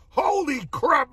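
slow, high holy crap; drawn-out amazement. Real, not acted. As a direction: stretch it out in disbelief. Same exclamation repeated.

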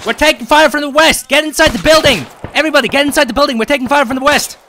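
loud, high battle yell; shouting warning. Real, not acted. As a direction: scream orders under fire. Under fire, ordering squad inside.

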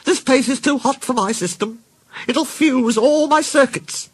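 very high complaining car voice; high-pitched, indignant storybook moan; grumbling. A narrator's reading. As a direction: complain high, drawing out the last grievance. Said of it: "she grumbled".

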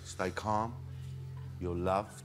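soft, low, soothing meditation voice; guided meditation. Acted. [name] reassures the meditating group, guided meditation. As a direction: soothe them, leaving long pauses.

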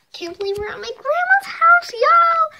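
excited squeal at grandma's house. Real, not acted. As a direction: burst out with delight.

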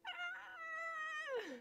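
high shrill scream of fright; high startled shriek. Acted. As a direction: scream at the sight of him. She screams at him.

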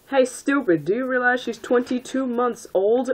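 high, scolding outcry; half-hearted scream. A narrator's reading. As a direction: scold them in a mock scream. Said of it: "I screamed".

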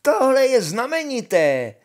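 high, loud, thrilled exclamation; excited shout. A narrator's reading. Honza boasts of a find to brothers, shouting excitedly. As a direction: proclaim a marvellous discovery at full voice.

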